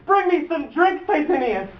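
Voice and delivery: high, mocking invalid's plea; sick girl. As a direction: beg like a sick girl, in mockery. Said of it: "as a sick girl".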